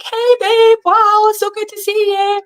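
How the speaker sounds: high, bright sing-song hello to a friend; sing-song greeting. Retold or imitated. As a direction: greet her with a little song in it.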